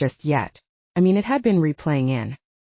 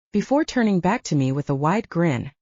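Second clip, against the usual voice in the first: usual speaking voice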